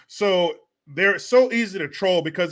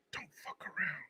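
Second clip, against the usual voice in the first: unvoiced inner-voice whisper; 21 dB quieter than usual. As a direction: whisper it like a conscience. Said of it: "a little voice that says".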